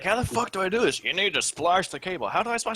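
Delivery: put-on high, angry old man rant; cranky old man. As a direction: rage at the phone lines like a grumpy old man. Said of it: "It's like".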